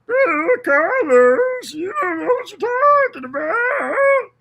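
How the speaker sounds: high, slow whiny woman voice mocking her. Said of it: "That's what she said".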